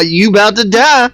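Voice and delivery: mock-menace a dying fish; teasing the fish. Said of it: "just teasing the fish".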